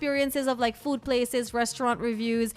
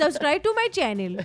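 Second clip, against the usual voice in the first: put-on playful Indian accent; Bollywood-style plea to subscribe; 4 semitones higher, 3 dB louder than usual. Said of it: "a nice Bollywood mix going on".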